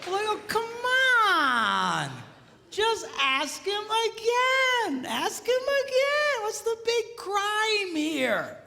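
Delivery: high, drawn-out mock plea; wailing, stretched-out protest; exasperated. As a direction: cry it out slowly in hammed-up exasperation.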